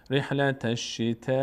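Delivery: "رحلت الشتاء" with the last aa held very long; madd elongation. Shown as a demonstration. Teacher demonstrating madd to learners, long held vowel. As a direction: stretch the aa of "الشتاء" far beyond natural length. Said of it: "این مد یعنی این".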